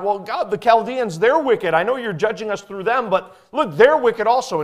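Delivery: high, loud protest; indignant prophet's complaint; crying out to God. Retold or imitated. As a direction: plead against the wicked Chaldeans. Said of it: "he cries out and says to God".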